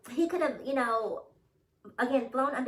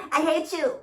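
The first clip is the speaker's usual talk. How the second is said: laughing mock hate; 6 semitones higher, 8 dB louder than usual. Repeated laughing, louder.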